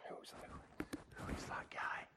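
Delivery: soft, near-whispered question; low-key acted curiosity; hushed inquiry. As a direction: ask it quietly amid the crowd. Jesus asking who called him.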